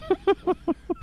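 squeaky high giggle; a quick high-pitched cackle. Retold or imitated. Bunny's laugh imitated behind.

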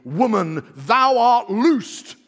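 put-on loud, slow old-Bible proclamation on thou art loosed; proclaimed loudly. Shown as a demonstration. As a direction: boom it out like the King James. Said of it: "I love this for, for some reason".

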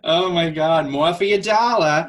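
put-on high, loud Boston accent; old-timey Boston accent. Friend riffing on Market Basket, loud Boston accent. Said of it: "the old timey Boston accent".

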